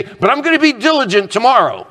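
acted-out good intention; earnest resolution. Voicing a resolution of the listener.

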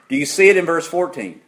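normal talking voice

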